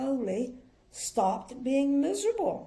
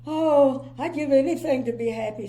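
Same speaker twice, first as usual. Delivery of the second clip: sniffy miserable voice; 3 semitones higher, 7 dB louder than usual. Acted. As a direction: sniff it out disdainfully. Mister Miserable pleads.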